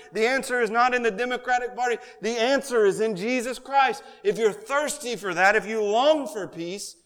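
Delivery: impassioned pulpit plea; preaching appeal. Acted. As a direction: press the longing home to the congregation.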